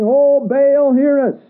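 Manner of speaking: crying out to Baal. Retold or imitated. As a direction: shout it up to an idol that won't answer. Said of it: "Now just picture this in your mind".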